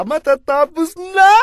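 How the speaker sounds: high, loud, slow cry of relief; nearly done with the paperwork, overjoyed. Acted. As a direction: shout it like finally free. Joy at near finish.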